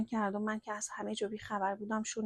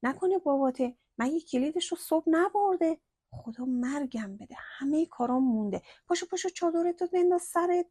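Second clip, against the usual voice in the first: higher, louder, rushing fluster; panicked, flustered; 6 semitones higher, 4 dB louder than usual. A narrator's reading. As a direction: scramble in alarm as the doorbell rings. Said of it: "هول کرد و با ترس گفت".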